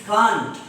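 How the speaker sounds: CAN'T with a long open "ah", like "kaant"; British can't. Shown as a demonstration. Teacher showing students British English, CAN'T with broad "ah". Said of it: "In British English, it is can't".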